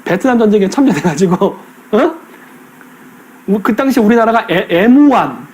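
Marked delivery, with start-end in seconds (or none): 4.7-5.5 put-on 엠완 said the old Japanese way, M-one as em-wan; 엠완 in the old Japanese-flavoured pronunciation; Japanese-style pronunciation. Shown as a demonstration. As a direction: say 엠완 like older Koreans used to. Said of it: "일본식 발음을 하니까 엠완인데".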